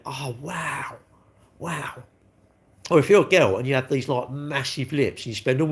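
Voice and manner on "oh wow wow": soft, breathy-sounding mock awe, hushed; mock gushing. Streamer mocking online fans with soft mock awe. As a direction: send up the dazzled online fan, quietly.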